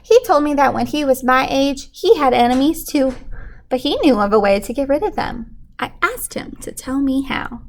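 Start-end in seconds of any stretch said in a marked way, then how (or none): none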